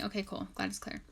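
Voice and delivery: usual speaking voice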